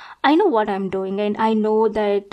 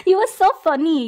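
laughing; 7 semitones higher than usual. Acted. Laughs at the victim's remark.

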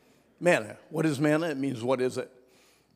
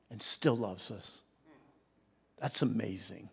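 soft and tender; 4 semitones lower, 6 dB quieter than usual. Real, not acted. Sudden drop after shouting.

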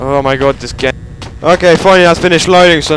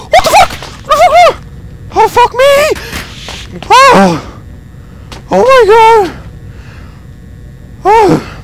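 terrified shrieking; slower than usual. Real, not acted. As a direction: shriek it in terror. Jump scare.

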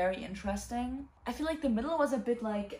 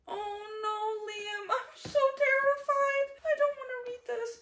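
much higher, slow scared whine; mock terror; 14 semitones higher, slower than usual. Reader telling Liam she is scared of the book, mock terror. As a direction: whimper like the book is frightening you.

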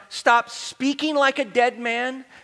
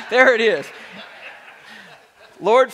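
normal talking voice

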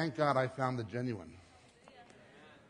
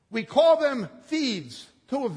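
slow emphasis; 9 semitones higher, 9 dB louder, slower than usual. Real, not acted.

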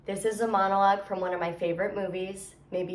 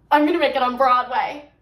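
loud, higher, determined declaration; defiant vow; 4 semitones higher, 10 dB louder than usual. Acted. Rejected dancer telling off Radio City, loudly defiant. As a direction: belt it out as a vow.

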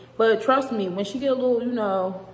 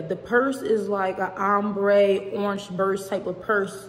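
ordinary delivery

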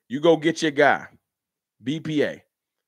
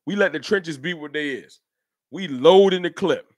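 ordinary talk, nothing marked

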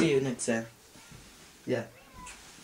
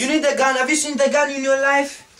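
menacing threat; 6 semitones higher, 5 dB louder than usual. Acted. Threatening the repairman.

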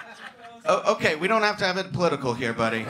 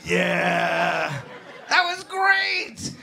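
mock cheer; much slower than usual. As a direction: cheer like a thrilled fan.

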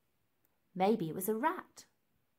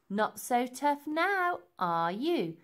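slow, loud, rising taunt; gloating playground sneer; 4 semitones higher, 4 dB louder, slower than usual. Acted. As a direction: draw it out mockingly, then drop on the tag. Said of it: "sneered Nellie".

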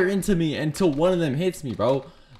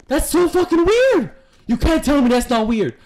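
incredulous rant; 10 semitones higher, 4 dB louder than usual. Real, not acted. Rant, pitch leaps.

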